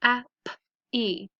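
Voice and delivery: "happy" sound by sound, h, a, p, ee; segmented phonics "happy". Shown as a demonstration. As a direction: separate each sound of "happy". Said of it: "So let's practice them".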